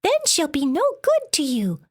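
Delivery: weasel voice. A narrator's reading. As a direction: plead quickly as a small weasel. Weasel advising the monster.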